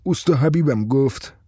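ordinary delivery

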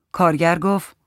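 plain reading voice

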